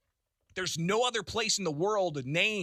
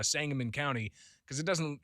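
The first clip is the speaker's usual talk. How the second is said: normal talking voice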